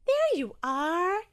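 a squishy, drawn-out party welcome; squishy Jellyfish. Acted. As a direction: greet him warmly and slowly. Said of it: "said a familiar squishy voice".

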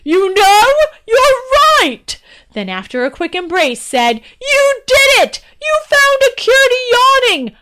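high, loud, delighted king; overjoyed king. Acted. King thrilled at his daughter's cure, loud and high. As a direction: burst out with joy, way up and loud. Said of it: "whirled around and laughed".

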